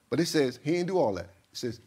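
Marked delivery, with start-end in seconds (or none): none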